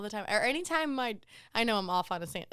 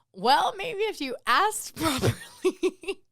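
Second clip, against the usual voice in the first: laughing sass; 8 semitones higher, 6 dB louder, much slower than usual. Retold or imitated. Sassing a rude customer.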